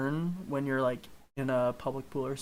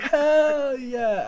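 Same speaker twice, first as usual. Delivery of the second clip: high, loud whoop of triumph; excited cheer; 11 semitones higher, 7 dB louder than usual. Retold or imitated. Answering the friends' cheer, a loud high whoop. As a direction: shout it back like a rallying cry.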